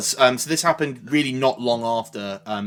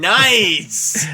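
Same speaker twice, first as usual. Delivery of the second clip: loud, high, drawn-out cheer; mock cheer; 8 semitones higher, 9 dB louder than usual. Guest hailing the host's crude number joke, loud mock cheer. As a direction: shout it high, in mock approval.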